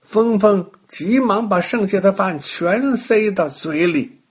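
ordinary delivery

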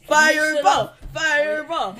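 gleeful fireball shout; excited shout. Pretend. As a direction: yell the spell with glee.

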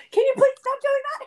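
high, quick, squeaky with laughter; high-pitched laughing movie line; laughing quote. Acted. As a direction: quote it through helpless giggles. Quoting a film scene amid laughter.

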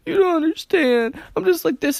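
high, loud imitation of a distraught friend; mock upset. Speaker imitating friend trying to be upset again, mock wailing. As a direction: wail it like someone working back into tears. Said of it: "tries to get in the emotion again".

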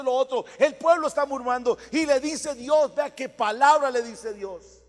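loud, building sermon cry; vurig preken. Real, not acted. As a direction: proclaim it to the congregation at full force. Preaching at full force.

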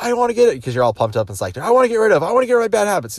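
high, loud eager shout; pumped-up crowd. Retold or imitated. Seminar crowd shouting back to Tony, pumped up. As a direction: yell like a fired-up fan.

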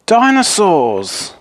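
high, drawn-out exclamation; stretched, high-pitched exclamation; excited discovery. Acted. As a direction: cry it out slowly in delighted discovery. Harry finds dinosaurs in box.

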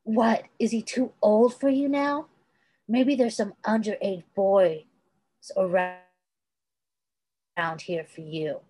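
a scornful jab; sneered. A narrator's reading. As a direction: sneer the taunt back at her. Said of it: "Stiles sneered".

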